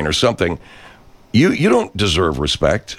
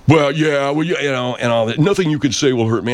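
higher, whiny mock of the troll; 8 semitones higher than usual. Mocking the troll to listeners: higher whiny mock voice. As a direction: lampoon the pompous troll, pitched up.

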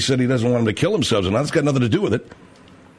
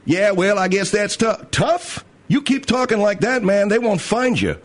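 mock threat; 5 semitones higher than usual. Acted threat in a quarrel.

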